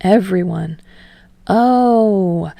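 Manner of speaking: ordinary delivery, nothing marked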